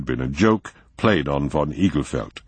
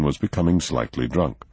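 ordinary delivery, nothing marked

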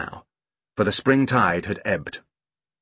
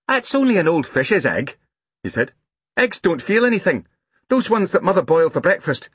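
put-on high little-boy voice; matter-of-fact small boy; 9 semitones higher than usual. A narrator's reading. Small boy reasoning with his upset sister, matter-of-fact. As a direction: explain it in a high child's voice.

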